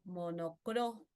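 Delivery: slow "monocromo", leaning hard on CRO; correcting slowly, "monocromo" with CRO held; stress on CRO. Shown as a demonstration. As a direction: say "monocromo" as mo-no-CRÓÓ... mo. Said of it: "Es monocromo".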